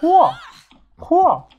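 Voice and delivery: high, loud astonished whoa; surprised whoa. Real, not acted. Amazed at loot he just found, high whoa. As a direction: squeal at the new find.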